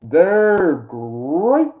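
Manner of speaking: big rolling growly "great", pitch swooping up; hammed-up catchphrase impression; cereal tiger mascot. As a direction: boom it like a cartoon mascot. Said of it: "the tiger always says".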